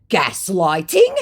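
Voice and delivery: loud, drawn-out incredulous query; mock outrage, slowly rising into a question; exclamation-question. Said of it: "Exclamation point, question mark".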